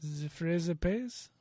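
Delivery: slow mock Spanish accent that slides into French. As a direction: try a Castilian Spanish accent, slowly. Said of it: "from Spain Spanish".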